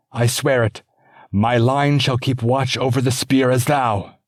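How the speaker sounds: a devoted squire pledging, loud and high; squire swearing an oath. Acted. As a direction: swear it like your life depends on it.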